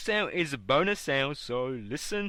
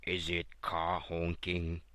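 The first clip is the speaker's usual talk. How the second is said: lower, slower contestant voice; 9 semitones lower, 6 dB quieter, slower than usual. Acted. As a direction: drop low and slow for a shy guest. Host voices contestant Joe.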